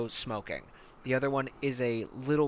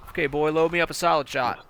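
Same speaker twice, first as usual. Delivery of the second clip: louder commanding order; barked order; 4 semitones higher, 7 dB louder than usual. Acted. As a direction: bark the order at the boy.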